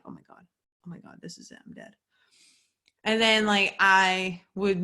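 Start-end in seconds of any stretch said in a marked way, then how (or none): 0.0-1.9 breathless whispered panic to herself; panicked whisper. Retold or imitated. Whispering her own panic to herself, mock terror. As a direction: whisper it like you think you're dying.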